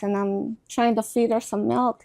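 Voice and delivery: normal talking voice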